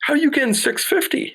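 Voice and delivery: high astonished retold question; amazed disbelief. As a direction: pitch jumps way up in disbelief. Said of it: "And I'm just looking going".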